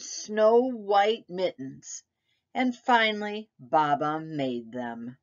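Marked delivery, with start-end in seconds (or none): none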